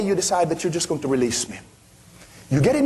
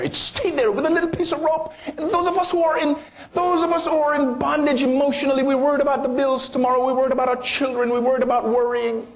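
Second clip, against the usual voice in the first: preaching at full force; 9 semitones higher than usual. Acted. Preacher rising in fervour.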